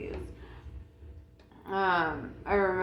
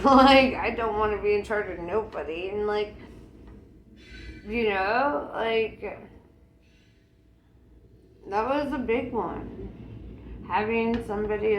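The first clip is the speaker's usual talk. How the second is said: normal talking voice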